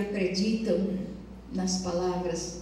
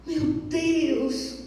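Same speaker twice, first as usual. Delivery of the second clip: shocked exclamation; 8 semitones higher than usual. Acted. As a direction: say it aghast at the baby. Son sees abandoned newborn. (exclamação chocada e aguda)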